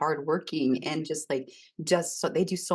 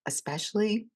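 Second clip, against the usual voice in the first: ordinary talk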